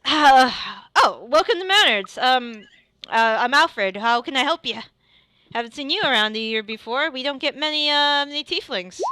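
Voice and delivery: hearty shopkeeper welcome; Alfred greeting. Acted. Blacksmith greeting a tiefling customer, lifted and hearty. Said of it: "And he says".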